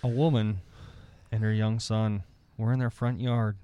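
lightly gruff storybook read; audiobook narration. A narrator's reading. Reading a made-up story aloud as the narrator, mock serious. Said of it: "I'm gonna read it in a gruff, gruff voice".

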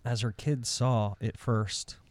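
lightly gruff storybook read; audiobook narration. A narrator's reading. Reading a made-up story aloud as the narrator, mock serious. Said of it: "I'm gonna read it in a gruff, gruff voice".

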